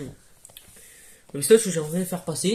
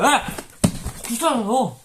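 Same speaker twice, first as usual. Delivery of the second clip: tic crié; 4 semitones higher, 5 dB louder, slower than usual. Acted. Tic de Tourette joué.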